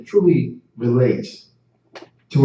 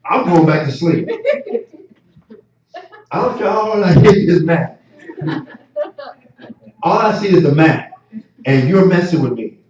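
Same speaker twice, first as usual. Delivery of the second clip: laughing while playing the sleepy baby; 4 semitones higher than usual. Preacher voices baby, laughing.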